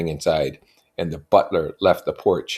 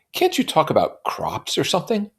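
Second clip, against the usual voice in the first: put-on high, airy young woman; lilting society girl; high voiced Daisy; Daisy's voice; 11 semitones higher, 4 dB louder than usual. A narrator's reading. Daisy voiced in read novel.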